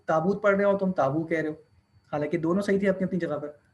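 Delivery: ordinary talk, nothing marked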